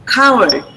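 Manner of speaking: "coward" starting on a k with a puff of air; aspirated k. Shown as a demonstration. Teacher explains aspiration, then models aspirated k in "coward". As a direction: breathe out audibly on the k of "coward". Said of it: "Aspirated means with a puff of air".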